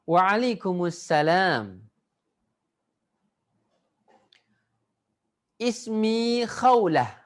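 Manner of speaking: slow, clear recitation for pupils to copy; model dialogue reading. Shown as a demonstration. Arabic teacher reading a greeting dialogue aloud for the class, slow and clear. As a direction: read each line out slowly for them to echo. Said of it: "Ikuti saya".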